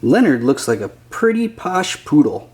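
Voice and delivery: usual speaking voice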